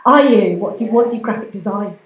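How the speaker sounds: ordinary talk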